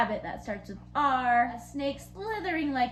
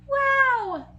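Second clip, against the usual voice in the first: big excited high wow; 13 semitones higher, 7 dB louder than usual. Real, not acted. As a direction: gasp in delight. Excited teacher reacts to chart.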